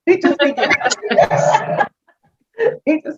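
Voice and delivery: words broken by a laugh, slowed. Real, not acted. Laughing to the host about her husband giving up on Creole. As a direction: chuckle through it.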